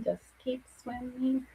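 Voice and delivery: lightly voiced Dory, slow and soft; sing-song Dory impression, hushed and slow; Dora moment. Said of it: "That Dora moment".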